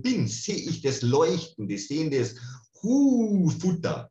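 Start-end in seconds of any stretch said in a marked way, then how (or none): 2.8-4.1 high, loud gasp at the food; a hungry creature suddenly spotting prey; a spirit eagerly sniffing out food; greedy excitement. Retold or imitated. Entity spots food.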